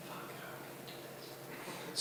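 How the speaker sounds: hushed, whispered worry; whispered plotting. Retold or imitated. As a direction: whisper it so he can't hear.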